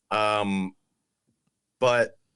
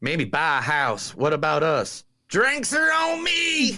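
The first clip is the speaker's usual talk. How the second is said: put-on Southern accent, rising to a high slow shout on the toast; 5 semitones higher than usual. Host quoting movie lines in a Southern accent. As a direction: ham up a Southern drawl, then whoop the last line. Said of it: "the Southern accent for every single quote".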